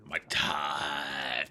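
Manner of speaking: TIME stretched out long, mimicking the odd delivery; mock the line, dragging "time" way out; mocking drawn-out imitation. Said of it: "Why did you say it like that?".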